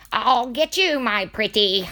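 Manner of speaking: high, gleeful wicked witch. Acted. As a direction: play the wicked witch taunting. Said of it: "tell me I sound like a witch".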